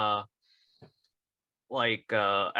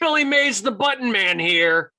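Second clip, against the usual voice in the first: loud, high pitchman announcement; Billy Mays shout; 7 semitones higher, 7 dB louder than usual. Acted. Riffing on the co-host's character as a pitchman, shouted. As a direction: open high and booming, then come down. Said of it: "he had to scream".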